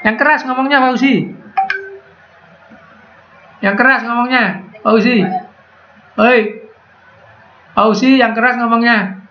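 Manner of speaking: high-pitched call to a student. Real, not acted. Calling on an inaudible student. (memanggil murid dengan nada tinggi)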